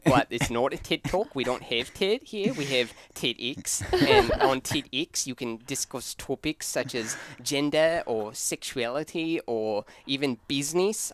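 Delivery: put-on Australian with upward inflections; Australian accent. Shown as a demonstration. Host demonstrating on request to friends, Australian accent. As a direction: explain it in a mock Australian TED-talk accent. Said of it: "How's your Australian accent?".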